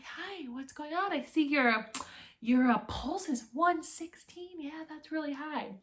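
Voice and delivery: lightly voiced gentle old doctor, starting in a whisper; a hint of a soft-spoken old man; elderly doctor. Recruit imitating the elderly MEPS doctor, lightly voiced. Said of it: "this elderly man".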